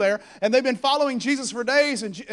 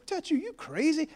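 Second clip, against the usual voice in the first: incredulous question; 7 semitones higher, 6 dB quieter than usual. Acted. Disciples incredulous at Jesus' question.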